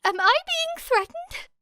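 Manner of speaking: high, anxious stammer; nervous squeak of a question; stammering Kaminari. A narrator's reading. Kaminari asking if Era threatens him, high stammer. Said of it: "stammered Kaminari".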